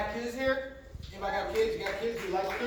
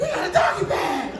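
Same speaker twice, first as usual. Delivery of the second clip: put-on high, loud laughing kid voice; 10 semitones higher, 10 dB louder than usual. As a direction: crack up while saying it. Kids laughing punchline.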